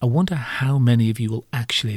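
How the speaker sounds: ordinary delivery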